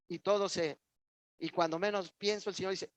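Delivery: ordinary talk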